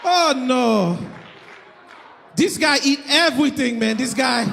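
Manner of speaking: imitated Haitian accent, lamenting. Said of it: "I can do the accent".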